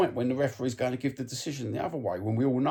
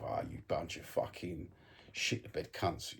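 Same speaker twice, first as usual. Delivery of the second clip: muttered inner insult; 5 semitones lower, 10 dB quieter than usual. Retold or imitated. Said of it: "I'm thinking to myself".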